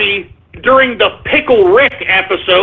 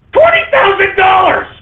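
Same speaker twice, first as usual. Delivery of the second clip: stunned shouting at the price; outraged shout; 8 semitones higher than usual. Real, not acted. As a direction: blurt the price out in disbelief.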